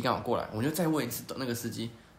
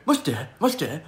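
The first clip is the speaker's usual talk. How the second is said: mock Japanese disbelief; 3 semitones higher, 6 dB louder, much faster than usual. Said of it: "我们两个就在对面".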